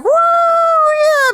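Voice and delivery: high, loud whoop of excitement; whooping cheer. Retold or imitated. Classroom guys cheering at a new girl, loud whoop. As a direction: cheer like the boys hearing a girl's coming.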